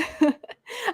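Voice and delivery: quick embarrassed laugh; short laugh. Real, not acted. Host apologizing for her soapbox, short laugh. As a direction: laugh at yourself.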